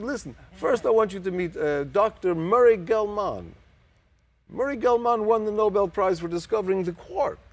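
put-on high, loud Russian-accented introduction; thick Russian accent. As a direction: imitate a proud, booming Russian-accented host. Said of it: "very thick Russian type accent".

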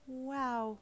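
awe. A narrator's reading. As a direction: breathe out a wow, mildly awestruck. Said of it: "you said in awe".

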